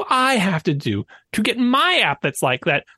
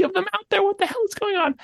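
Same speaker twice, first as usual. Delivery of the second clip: exasperated outburst; 12 semitones higher, 3 dB quieter than usual. Real, not acted. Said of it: "I'm so angry".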